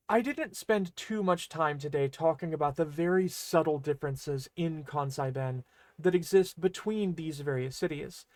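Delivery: normal talking voice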